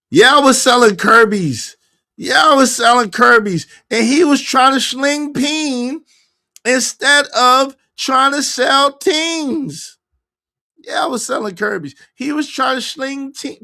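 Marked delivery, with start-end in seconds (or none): 0.1-10.0 loud, slow, high-pitched retelling. Retold or imitated. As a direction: belt it out, dragging the end. Repeats a quote in a loud voice.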